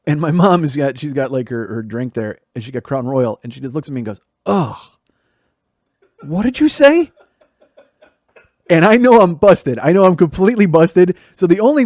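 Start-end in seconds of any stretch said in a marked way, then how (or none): 4.5-7.1 high, loud, incredulous demand; pointed, high-pitched maternal challenge; mom's outraged challenge. Retold or imitated. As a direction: imitate an offended mom demanding an explanation. Mom confronts him at dinner.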